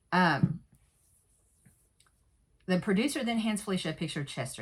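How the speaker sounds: normal talking voice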